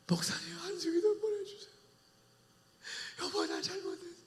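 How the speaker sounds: high, soft, tearful begging; pitiful sobbing apology; whimpering plea. Acted. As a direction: beg the pastor in tears. Humbled sick person begging.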